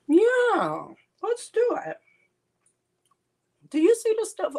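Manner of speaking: high, loud and eager; excited. Real, not acted. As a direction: jump in eagerly.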